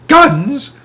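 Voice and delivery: shocked high gasp of a question. A narrator's reading. Boy startled to hear keepers carry guns, gasping. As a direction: gasp it out in alarm. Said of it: "I gasped".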